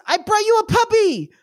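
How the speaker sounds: put-on high, gleeful little-boy announcement; excited kid. Kid presenting a capybara to his brother, high excited voice. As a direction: burst out high with proud news. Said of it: "he says".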